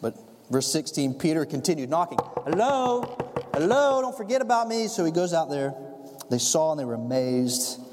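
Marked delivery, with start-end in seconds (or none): 2.4-4.9 higher, plaintive call through a gate; calling at the door. Acted. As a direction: call out, a little left behind. Peter keeps knocking.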